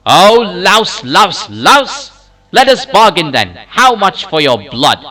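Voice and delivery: loud, high outburst of rage; raging. A narrator's reading. Popocatépetl to little animal over the price, raging. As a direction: roar it at a despised creature. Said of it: "roar and shake in his rage".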